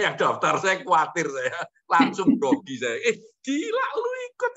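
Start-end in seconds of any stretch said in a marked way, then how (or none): 3.1-4.5 squealing very high in retold disbelief; high, playful cry of surprise; mock shocked squeal. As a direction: replay the shock at hearing the news. Retold shock at friend enrolling.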